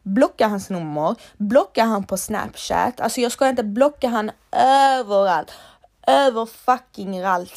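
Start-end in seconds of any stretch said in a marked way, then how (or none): 3.0-7.6 louder, pressing insistence; emphatic outburst. Real, not acted. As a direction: hammer home the point loudly. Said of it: "insisting to block him". (eftertryckligt och högre)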